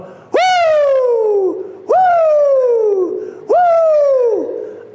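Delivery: high, loud whooping Woo; imitated woo cries. Old preacher imitating the shouting man's whoops.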